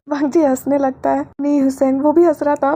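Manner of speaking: ordinary delivery, nothing marked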